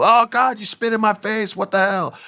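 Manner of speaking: high, loud mock outrage; outraged spit victim. Io getting misted, hammed-up high outraged cry. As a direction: shriek it in disgust.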